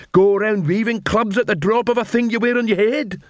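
high-pitched troll tirade; fed-up troll. Acted. Detritus scolds trolls for shaming them before humans, fed-up troll.

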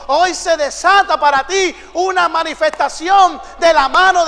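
loud, slow, declaiming proclamation; a pastor's booming, slow blessing cry; full-force preaching. Acted. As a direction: thunder each line to the congregation.

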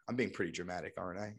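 quiet self-aware aside; sheepish realization. Retold or imitated. Realizing he overreacted to Marlene, quietly sheepish. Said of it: "I was like".

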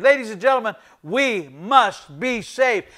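usual speaking voice

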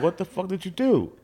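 high, stunned disbelief; shocked outburst. Retold or imitated. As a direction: pitch it up in shock. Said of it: "And I'm like".

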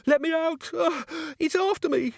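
high panicked cry to be let out; terrified. Acted. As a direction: shriek it like something is chasing you. Fleeing terror down tower stairs.

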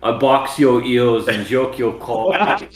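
parroting the line in a clumsy British voice; mocking English accent. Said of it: "English person with a speech impediment".

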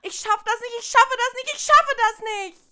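high, loud overwhelmed wail; rising hysterical outburst; panicked breakdown. Acted. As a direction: fall apart, crying you can't cope.

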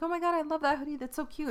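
high-pitched gush without extra volume; squealy retold admiration. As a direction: imitate yourself cooing over something cute. Retells gushing over sister's hoodie.

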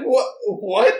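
taken aback, voice jumping up; thrown off. Retold or imitated. Reacting to an unexpected reply, thrown off. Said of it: "like, threw me off".

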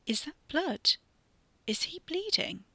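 high-pitched, worried questioning; alarmed question. A narrator's reading. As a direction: ask in rising alarm, without shouting. Katie discovers blood on him.

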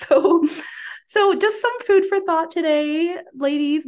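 high, slow, cheerful wrap-up; bright sign-off. Real, not acted. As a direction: sign off with a big lift.

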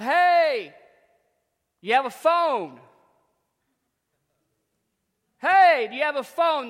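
loud, high shout across the field; yelling out. Retold or imitated. Stranded coach yelling to a farmer for a phone. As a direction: call out to the distant farmer. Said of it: "he yelled out".

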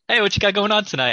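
high, fast, peppy barista voice; upbeat barista impression. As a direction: chirp it like an overeager barista. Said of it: "They're like".

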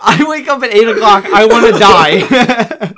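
laughing. Real, not acted. Joking about waking early.

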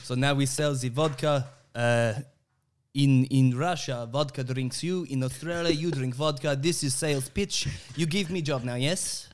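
put-on Russian sales pitch; Russian accent.

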